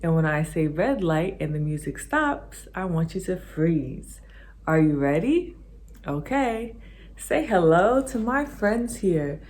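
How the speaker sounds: ordinary talk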